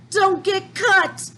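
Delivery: high, frantic warning cry; panicked shout. Acted. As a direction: yell it out in terror. Citizens fleeing in panic.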